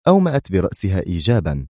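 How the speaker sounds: usual speaking voice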